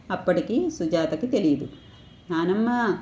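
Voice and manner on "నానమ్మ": ordinary delivery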